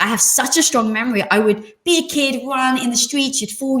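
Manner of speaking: usual speaking voice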